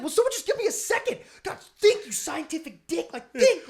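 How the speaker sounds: high, loud, frantic self-scolding; mock panic. Scientist character begging for a second, mock frantic. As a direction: imitate an actor flustered and urging himself to think. Imitating Wahlberg's panicked outburst in film.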